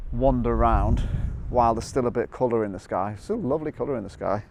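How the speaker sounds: normal talking voice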